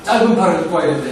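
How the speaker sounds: fast run-on "짧은 팔을 입고 와야 됩니다"; fast, run-on delivery of "짧은 팔을 입고 와야 됩니다"; rushed run-on. Shown as a demonstration. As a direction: rush through it as one long sentence. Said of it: "이게 아닙니다".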